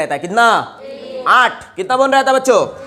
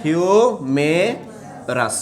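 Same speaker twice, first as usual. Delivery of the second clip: very slow, each sound of ह्यूमेरस stretched out; slow drawn-out answer; 3 semitones lower, 4 dB quieter, much slower than usual. Shown as a demonstration. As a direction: say ह्यूमेरस syllable by syllable, slowly. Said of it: "good, नाम बताओ इसका". (ह्यूमेरस को बहुत धीरे, खींचकर बोलो)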